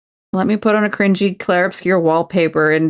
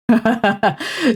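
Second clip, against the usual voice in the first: higher, quick chuckle; chuckling; 5 semitones higher than usual. Real, not acted. Streamer joking about turning twenty-seven again, chuckling. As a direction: laugh at your own joke.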